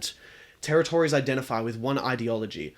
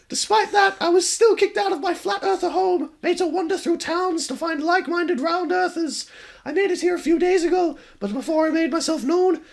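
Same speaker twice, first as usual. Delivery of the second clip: put-on very high, louder girl voice with a wry edge; the ghost shrugging off her fate; high wistful ghost girl; 7 dB louder than usual. Ghost girl tells her story.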